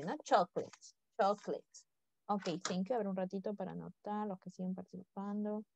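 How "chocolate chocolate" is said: "Chocolate" in two beats, CHOK-lit; English pronunciation. Shown as a demonstration. Teacher showing it differs from Spanish, softly modelling English "chocolate". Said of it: "pero se pronuncia diferente".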